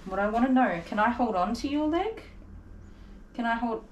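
ordinary talk

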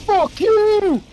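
furious scream. Real, not acted. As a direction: scream it at the enemy at full force. Furious at game enemy.

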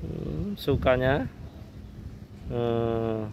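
ordinary delivery

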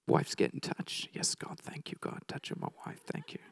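whispered, barely voiced thanks to God; hushed prayer. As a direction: pray under your breath, imitating a grateful husband. Brother's private prayer during altar call.

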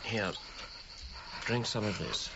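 soft, low coaxing to a sick man; gentle nursing. Acted. Friend helping the feverish archaeologist drink, gently. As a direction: ease him into drinking, softly.

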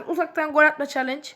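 ordinary delivery

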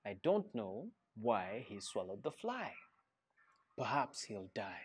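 softly, like a dark secret; hushed refrain. A narrator's reading. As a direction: drop the voice for the spooky bit. Ominous refrain in read-aloud.

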